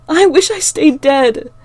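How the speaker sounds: high, loud, slow choking sob; choked out. Acted. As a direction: squeeze it out through sobs. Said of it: "I choked out".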